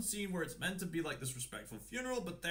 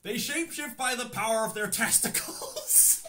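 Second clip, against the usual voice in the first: louder, higher, laughing blurt; 7 semitones higher, 6 dB louder than usual. Real, not acted. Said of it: "just get it over with".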